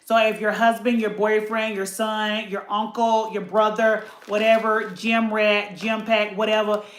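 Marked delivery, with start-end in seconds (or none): none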